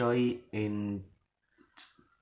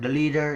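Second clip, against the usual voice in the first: "the leader" louder and climbing; rising intonation; 5 semitones higher, 6 dB louder, faster than usual. Shown as a demonstration. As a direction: swing "leader" upward. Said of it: "is rising".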